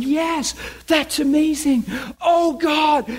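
high-pitched excited mock yell; reenacted ecstatic yelling. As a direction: act out yelling with elation, pitch way up. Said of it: "I'm yelling".

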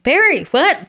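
high, loud alarmed call of a name; shouting to her husband across the house; retold yell. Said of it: "and I am yelling at him".

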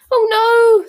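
high, loud squeal of panic. A narrator's reading. Hermione seeing the envelopes, squealing. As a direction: let it out as a shriek. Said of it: "squealed Hermione".

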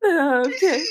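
high okay through giggles; laughing. Real, not acted. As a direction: still cracking up, trying to resume. Recovering from a laughing fit.

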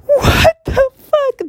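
very high, slow crying shriek; shocked shriek. Retold or imitated. Stunned opening-night audience reacts to the twist: high crying shriek. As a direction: wail it out like a stunned moviegoer. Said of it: "everybody in the theater goes".

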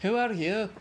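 put-on ogre accent, pitched above the first take; Shrek's accent. Partner asks for the ogre's line in the accent, accented impression. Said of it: "do it in the accent".

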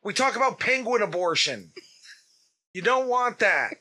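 high, deliberate mock-announcer voice; public service announcement. Host warning parents about children listening, mock PSA voice. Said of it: "This is a public service announcement".

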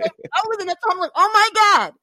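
high, frantic disbelief; spiraling. Real, not acted. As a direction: voice climbing in panic. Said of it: "And I am spiraling".

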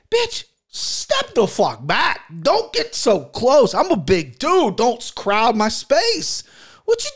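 high, drawn-out exasperation; indignant rant. Pretend. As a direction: stretch out a high-pitched complaint. Mock rant at space-crowding woman.

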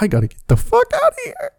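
high, fast panicked squeal; panicked squeak. Retold or imitated. Comedian fleeing the angry stripper, high panicked squeal. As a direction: squeal it out in sudden terror.

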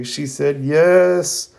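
high and very slow, drawn out in mock tears; mock tearful. Lee telling Guy Sensei Sakura said yes, in hammed-up joyful tears. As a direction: stretch out "She said yes." like a hammed-up sob of joy. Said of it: "Rock Lee immediately starts crying".

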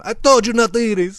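high-pitched scolding mom; mad mom. Acted. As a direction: scold like a hammed-up Italian mother. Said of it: "His mom's all mad at him".

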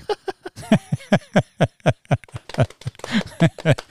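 long, high wheezing laugh held back; stifled laugh. Real, not acted. Podcaster recording in a lodge, stifling a laugh. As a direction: try to hold the laughter in. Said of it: "I'm trying to stifle my laugh".

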